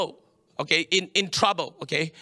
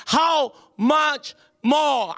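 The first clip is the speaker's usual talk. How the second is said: HOW MUCH MORE, each word drawn out high on its own; emphatic stress; 7 semitones higher, 4 dB louder, much slower than usual. Real, not acted. Preacher underlining a verse for the congregation, heavy stress on "how much more". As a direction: lean slowly and hard on every word of "how much more". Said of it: "underline the word".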